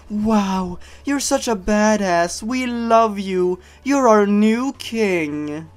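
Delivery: high, slow mock-worshipful praise; mock adoring crowd. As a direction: gush it like a fawning fan club. Mock cheering crowd quote.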